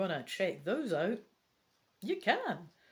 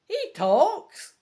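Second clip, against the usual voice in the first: high, loud cry of surprise; astonished shout; 12 semitones higher, 10 dB louder than usual. Acted. Sally startled by the talking owl, shouting. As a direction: shout it in disbelief, pitch leaping up. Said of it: "Sally shouts".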